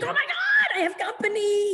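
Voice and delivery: the dog bursting with joy at a visitor; thrilled dog voice. Retold or imitated. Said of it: "He's like".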